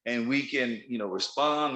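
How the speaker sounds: ordinary talk, nothing marked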